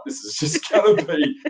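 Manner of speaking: amused retelling breaking into laughter; laughing. Retold or imitated. Laughing while retelling his nervous thought.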